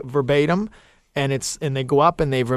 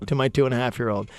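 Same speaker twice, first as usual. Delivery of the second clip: ordinary delivery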